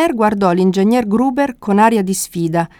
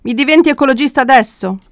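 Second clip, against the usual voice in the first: higher, quick challenging question; quick and raised in pitch; exclaimed question; 6 semitones higher, faster than usual. A narrator's reading. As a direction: exclaim it as a fast, incredulous question. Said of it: "esclamò Ghigo".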